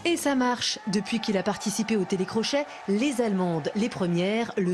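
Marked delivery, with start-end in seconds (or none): none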